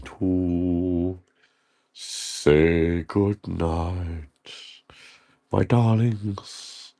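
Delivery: slow, drawn-out goodnight; a slow, affectionate farewell; tender sign-off. Real, not acted. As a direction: stretch the goodbye out fondly. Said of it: "signing off".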